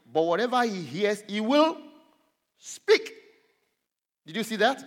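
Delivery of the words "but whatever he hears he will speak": "speak" set apart, high and loud; emphatic scripture reading. Real, not acted. Preacher reading a verse to the congregation, emphatic. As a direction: build up to a punched "speak". Reads verse, stressing the verb.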